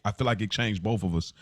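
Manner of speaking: normal talking voice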